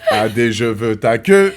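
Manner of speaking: "Adé, je veux ta queue" slow, laughing through; laughing model. Shown as a demonstration. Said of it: "laughing model line". (modèle en riant)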